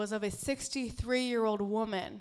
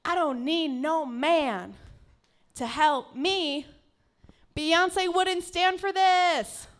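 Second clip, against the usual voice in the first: slow, loud, higher and defiant; proud defiance; 7 semitones higher, 7 dB louder, much slower than usual. Retold or imitated. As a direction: declare it like an anthem. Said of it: "in my head, I was like ... very proud".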